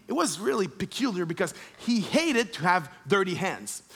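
usual speaking voice

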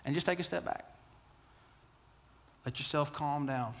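ordinary delivery

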